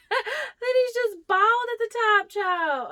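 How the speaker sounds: squeaky high laugh-crying through the words. Real, not acted. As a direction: crack up while saying it. Laughing at the bald plant.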